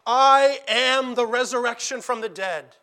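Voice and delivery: I am the resurrection from the dead, declared loud with the weight on I; proclaimed quote. Shown as a demonstration. Preacher quoting Christ to Martha, loud proclamation. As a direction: ring out I as the speaker. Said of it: "Not, "I offer it," not, "I have it".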